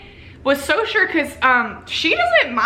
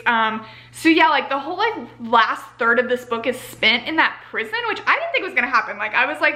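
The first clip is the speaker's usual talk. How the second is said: ordinary delivery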